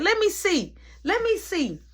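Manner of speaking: high-pitched nosy call; eager girl inmate. Retold or imitated. Girl inmate wanting a look, high eager call. Curious girl in another cell.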